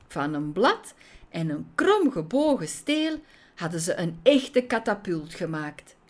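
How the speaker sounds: ordinary delivery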